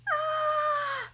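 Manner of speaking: very high, loud shriek; scream. Acted. Snake lunging, high panicked scream.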